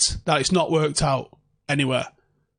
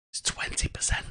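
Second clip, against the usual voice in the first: hushed reveal; 10 dB quieter than usual. Real, not acted. To viewers, revealing a shocking stat in a whisper. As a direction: whisper the stat, unvoiced and soft.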